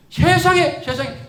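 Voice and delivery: a loud, high burst of disbelief; loud, high amazed gasp of a word; amazed exclamation. Real, not acted. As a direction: cry out in wonder at surviving. Recalling near death.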